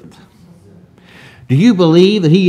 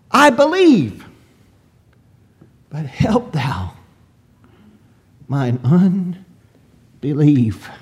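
brutally honest confession. Acted. Father admitting his doubt to the Lord, honest confession. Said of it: "he's very brutally honest".

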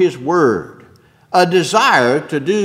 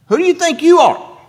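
high, loud incredulous retort; an affronted crowd demanding who he is; indignant challenge; 14 semitones higher, 7 dB louder, faster than usual. Retold or imitated. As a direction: throw it back at him, offended and scornful.

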